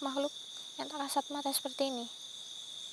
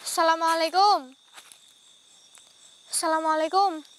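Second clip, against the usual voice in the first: loud summons; 8 dB louder than usual. Real, not acted. Summoning the ghost at the hanging spot, loud. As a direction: call the spirit out.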